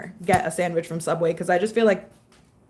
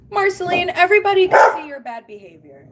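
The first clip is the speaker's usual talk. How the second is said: high, loud pet scold; scolding the dog; 8 semitones higher, 4 dB louder than usual. Real, not acted. Owner calling out her dog Marceline, high loud scold. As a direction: call the dog out for misbehaving.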